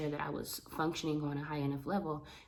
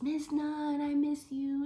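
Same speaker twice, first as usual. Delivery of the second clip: put-on high, sweet kid voice; little boy voice; 11 semitones higher, 5 dB louder, slower than usual. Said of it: "he just says".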